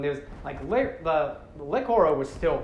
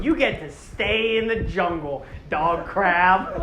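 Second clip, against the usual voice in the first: slow, louder emphatic send-off; mock dismissal; 4 dB louder, slower than usual. Telling the crab to stay behind, emphatic mockery. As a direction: dismiss it with comic relish.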